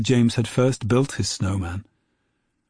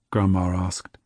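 usual speaking voice

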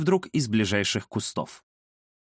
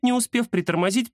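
plain reading voice

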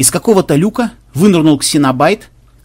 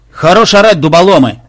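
loud, higher barked rebuke; megaphone bark; 4 semitones higher, 7 dB louder than usual. A narrator's reading. Pirate boss silencing his yelling sailors, barked through a megaphone. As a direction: bark at the rowdy crew to shut up. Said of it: "рявкнул в свой рупор Махмуд".

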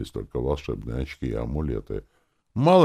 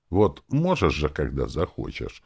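ordinary delivery

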